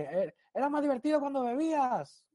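high, mocking call from across the street; heckler shouting. Stranger on the street heckling him, high mock shout. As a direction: yell it at him from far off like a passer-by. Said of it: "me gritan uno, así de lejos".

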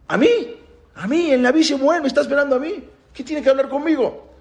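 high-pitched incredulous questioning; astonished. Acted. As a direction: ask in high, amazed disbelief. Young David astonished at prophet's summons.

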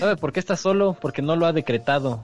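slow, rising self-help sermon; motivational preacher. Acted. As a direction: preach the power of decreeing. Mock motivational guru.

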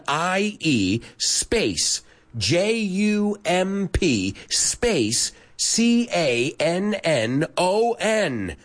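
letters slowly spelled out one by one; slow spelling. Shown as a demonstration. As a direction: spell each letter slowly and clearly for the class. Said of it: "I'm gonna give you six people".

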